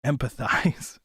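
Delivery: "Empathize" fully whispered. Shown as a demonstration. Teasing chat with the word of the day, whispered. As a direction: breathe "Empathize" without voice. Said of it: "Here's your word of the day".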